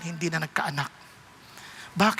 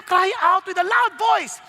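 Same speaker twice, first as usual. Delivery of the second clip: raised, higher-pitched preacher's call; loud emphatic cry; 7 semitones higher, 3 dB louder, slower than usual. Shown as a demonstration. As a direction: hammer out each word, voice lifted.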